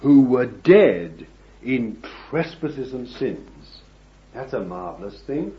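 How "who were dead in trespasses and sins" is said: slow, weighty reading of the verse; scripture reading. Real, not acted. Preacher reading Ephesians aloud to the congregation, slow and solemn. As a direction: lean on each word like holy writ. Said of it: "this is what it says".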